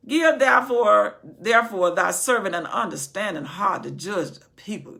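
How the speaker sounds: loud sermon reading; booming pulpit reading; preaching scripture. Acted. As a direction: proclaim the verse. Reading scripture at full force.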